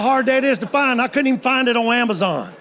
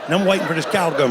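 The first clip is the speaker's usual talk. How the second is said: ordinary delivery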